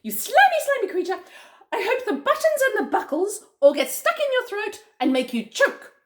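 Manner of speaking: high, loud monkey tirade; crying out. Acted. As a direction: curse the villain in a squealing monkey voice. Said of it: "cried Mugglewump".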